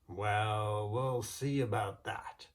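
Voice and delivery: a proud, booming boast; boastful giant Finn. Acted. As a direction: bluster like a big brave giant.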